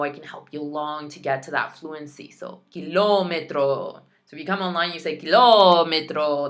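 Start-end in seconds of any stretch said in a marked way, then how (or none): 2.7-4.0 "kilómetro" slow, with a long stressed LO; over-exaggerate the LO in "kilómetro"; exaggerated stress. Shown as a demonstration. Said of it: "feel free to over-exaggerate your sounds". 5.2-6.5 "kilómetro" with a very loud, stretched LOOO; over-exaggerated stress. Shown as a demonstration. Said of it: "feel free to over-exaggerate your sounds".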